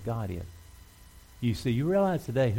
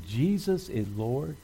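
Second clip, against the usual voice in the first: slow, stretched-out declaration, pitched higher; leading a declaration; 4 semitones higher, slower than usual. Real, not acted. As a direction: draw out each word for the congregation to repeat. Leads congregation in a declaration.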